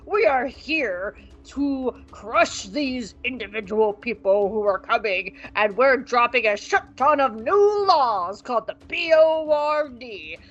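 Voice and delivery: slow, pompous Imperial speech; Yularen voice. Retold or imitated. Yularen lecturing ISB agents, slow pompous speech. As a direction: drag out each word like a self-important officer. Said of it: "I got lightheaded doing that voice".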